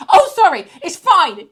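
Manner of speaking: caught-off-guard stranger brightly telling her to carry on; awkward polite bloke. Retold or imitated. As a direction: play the embarrassed passer-by, loud and chirpy. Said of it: "bloke was just going".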